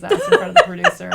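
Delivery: fast, loud, laughing through it; can barely tell it for laughing. Real, not acted. Telling friends the story, laughing hard.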